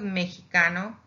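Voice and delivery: "mexicano" said as me-hee-KA-no. Shown as a demonstration. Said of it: "mexicano with a J".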